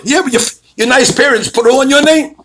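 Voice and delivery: high, loud, sarcastic comeback; imitated sharp retort. Attorney answering the client's protest, loud and high.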